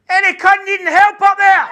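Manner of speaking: high loud shout up the close; calling up the stairs. Retold or imitated. Paramedic shouting up the close, loud. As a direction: bellow up the stairwell. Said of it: "He put his head in the door and said".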